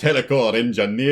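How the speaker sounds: rolling Scottish burr on the name; Scottish accent. Pretend. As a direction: say it like a Scot. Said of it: "You always do a Scottish accent".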